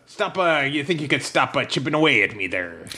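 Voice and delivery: ordinary talk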